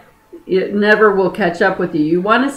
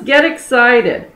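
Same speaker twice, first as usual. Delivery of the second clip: ordinary delivery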